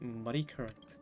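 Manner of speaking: ordinary talk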